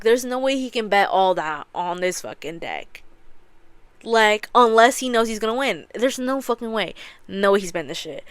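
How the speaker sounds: normal talking voice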